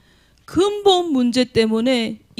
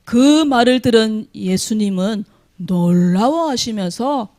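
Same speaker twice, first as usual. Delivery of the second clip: ordinary talk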